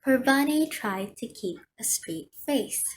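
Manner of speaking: ordinary delivery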